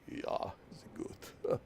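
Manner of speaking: slow, hushed mock German voice; German dub voice. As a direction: breathe it like a dubbed Stallone. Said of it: "hired some deep voice actor".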